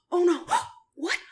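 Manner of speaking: high gasping fake surprise; mock gasp. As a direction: overplay a shocked gasp. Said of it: "make the reactions as genuine as possible".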